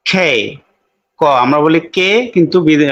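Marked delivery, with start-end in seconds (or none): 0.1-0.3 খে said with a breathy aspirated kh; native-style খে with aspiration. Shown as a demonstration. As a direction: puff air on খে. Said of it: "ক sound হচ্ছে কোনটা".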